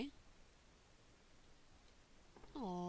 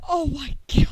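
scream; 14 semitones higher, 9 dB louder than usual. Real, not acted. Jump scare in horror game.

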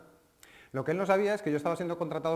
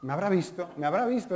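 anxious customer; 5 semitones higher, 6 dB louder than usual. Retold or imitated. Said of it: "es la frase".

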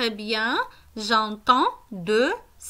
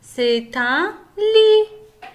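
C'est un lit drawn out slowly, a little louder; slow and clear; 3 semitones higher, 5 dB louder, much slower than usual. Shown as a demonstration. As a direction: say it very slowly for a child. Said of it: "J'entends une syllabe". (lentement et clairement)

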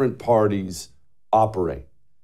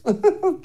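short, high scoffing laugh; mocking chuckle. Real, not acted. Host laughing at Republicans he just mimicked, mocking chuckle. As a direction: chuckle at their foolishness.

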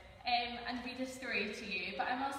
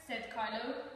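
ordinary delivery, nothing marked

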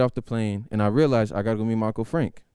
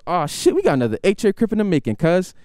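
higher, louder gang uncle; excited gangster uncle; 8 semitones higher, 4 dB louder than usual. Retold or imitated. Uncle thrilled his nephew is a Crip, high and loud. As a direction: hype it up like a proud Crip. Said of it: "He goes".

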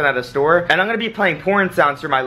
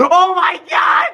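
shrieking laughter; slower than usual. Real, not acted. Prank succeeds.

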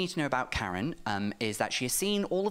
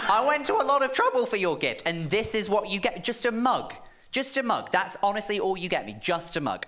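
high, loud furious rant; mock furious rant at the boyfriend; 6 semitones higher, 4 dB louder than usual. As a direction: rant at him in fury over the mug. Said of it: "I'm just furious".

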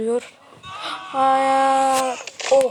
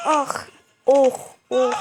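usual speaking voice

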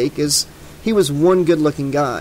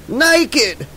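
high-pitched holler; whooping, high shout; excited cry; 10 semitones higher than usual. A narrator's reading. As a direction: cry it out like a rowdy dare. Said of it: "cried Duke".

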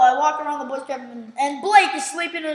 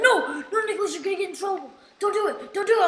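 higher-pitched pleading warning, quickened; alarmed protest; 5 semitones higher, faster than usual. Retold or imitated. Friend warning the prankster off, alarmed protest. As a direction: plead with him not to do it.

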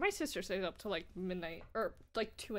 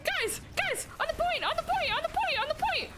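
frantic calling; 13 semitones higher than usual. Real, not acted. Urging team onto the point.

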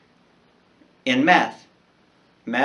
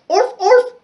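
very high, loud yappy bark; scared puppy yapping; small dog barking; 10 dB louder than usual. Acted. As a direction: imitate a frightened little dog. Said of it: "Teddy barked".

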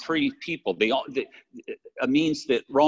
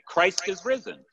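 higher, emphatic proclamation; more emphatic; 6 semitones higher than usual. Retold or imitated. Pastor repeating the Easter greeting to his church, more emphatic. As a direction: proclaim it with more force, pitched up. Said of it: "he said more emphatically".

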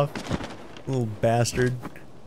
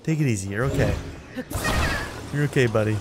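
soft, lower calming words to the little creature; soothing; 3 semitones lower, 5 dB quieter than usual. Real, not acted. Player calming the panicking Korok gently. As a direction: settle him down gently.

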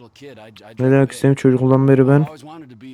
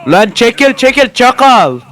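high, loud shout, the last word dragged out; yelling; 8 semitones higher, 4 dB louder, slower than usual. Real, not acted. Player shouts at a car in his path, loud. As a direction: roar at the car in the way.

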